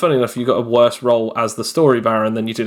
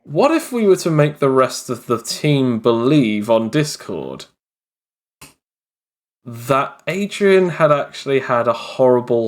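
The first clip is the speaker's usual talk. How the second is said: normal talking voice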